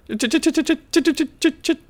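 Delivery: high stuttering falsetto; mock flustered grandma. Grandma covering for the cherry pie, mock high stammer. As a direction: stick on the first sound in old-lady falsetto. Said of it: "Grandma's like".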